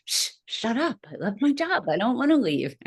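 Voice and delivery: high, protesting hush; brushing off inner voice. Retold or imitated. Nurse hushing her intuition, high protest. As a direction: shush the nagging voice away, up high. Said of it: "And I kept going".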